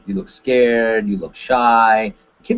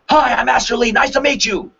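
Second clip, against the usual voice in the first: aggressive, raised-voice introduction; mad, angry; 12 semitones higher than usual. Shown as a demonstration. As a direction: fire the introduction out angrily. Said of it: "You know, mad, angry".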